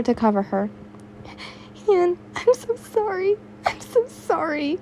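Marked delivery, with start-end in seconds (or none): 1.9-4.8 high, soft, crying apology; tearful apology. Acted. Uraraka apologising to Yin while crying, high and soft. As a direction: sob out the apology in a high small voice. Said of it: "Uraraka broke down".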